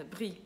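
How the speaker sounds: brie softly, with R scraped in the throat; throaty French R. Shown as a demonstration. Teacher repeats to show the R, throaty French brie. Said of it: "tem o R coçando a garganta".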